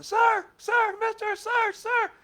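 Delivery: put-on high, loud child's call. Kid calling after the pastor on the street, shouting. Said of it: "I heard some kid calling".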